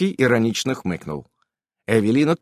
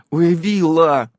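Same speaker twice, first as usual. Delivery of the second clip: slow, louder outraged cry; indignant mother's oy; 7 semitones higher, 6 dB louder, much slower than usual. A narrator's reading. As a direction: scold the girl in shock. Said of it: "возмутилась Ульянка".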